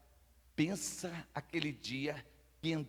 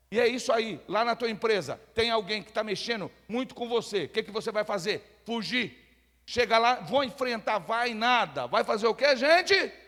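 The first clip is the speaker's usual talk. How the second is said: raised, higher-pitched sermon voice; forceful preaching; 7 semitones higher, 7 dB louder than usual. Real, not acted. Preacher warning workers against confronting others, forceful preaching. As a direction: press the congregation, building to a loud question. Preaching about trouble at work.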